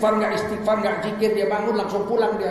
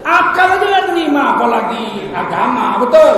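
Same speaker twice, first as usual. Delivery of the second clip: emphatic preaching; 4 semitones higher, 7 dB louder than usual. Real, not acted. Preacher drives point home.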